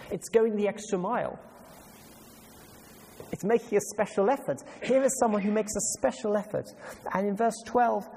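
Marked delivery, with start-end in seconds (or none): none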